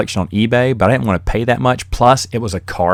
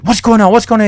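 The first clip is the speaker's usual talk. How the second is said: dad demanding; 12 semitones higher, 6 dB louder than usual. Retold or imitated.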